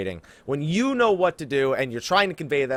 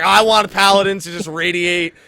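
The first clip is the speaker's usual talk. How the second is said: mock demanding player; 3 semitones higher, 8 dB louder than usual. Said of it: "I'm just giving you an idea of what you shouldn't say".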